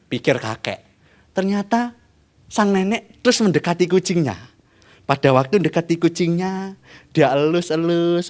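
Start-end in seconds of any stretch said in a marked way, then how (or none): none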